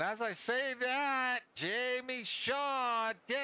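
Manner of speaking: rising alarm, high and drawn out; excited. Real, not acted. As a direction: call the moment as it happens. Sudden wreck during commentary.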